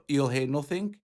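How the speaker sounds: usual speaking voice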